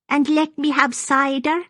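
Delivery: put-on high-pitched, eager old woman; childlike anticipation. A narrator's reading. Maggie follows Fiona for cider, childlike eager high voice. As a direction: ask eagerly like a hopeful child. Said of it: "agreed with childlike anticipation".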